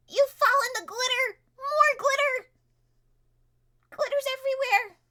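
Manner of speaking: high, loud shouting race commentary; frantic excited shouting. Acted. GM shouting excitedly as everyone rushes the key.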